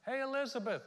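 high, loud singsong hail; calling out. Acted. Mary arriving at Elizabeth's house, calling out high and loud. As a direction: call into the house for her. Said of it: "she calls out".

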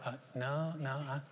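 soft, hesitant mumbled denial; sheepish mumble. Retold or imitated. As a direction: shyly fumble the answer. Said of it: "You're like".

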